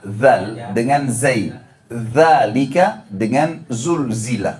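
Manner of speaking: slow "zal" and "Zalika" against "zai" and "zulzila"; zal versus zai. Shown as a demonstration. Teacher to congregation, slowly contrasting zal and zai. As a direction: draw out each letter slowly so they differ. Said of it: "Beda, beda dengan zal".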